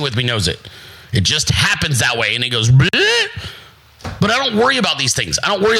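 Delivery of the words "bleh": high, loud dismissive blurt; mock bleh. How a group outshone by him goes, loud bleh. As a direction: spit out a silly bleh.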